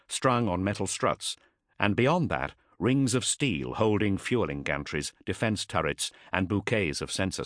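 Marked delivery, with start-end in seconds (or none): none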